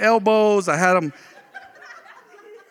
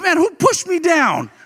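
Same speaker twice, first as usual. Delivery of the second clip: startled outcry; 11 semitones higher than usual. Retold or imitated. Confused after a fall.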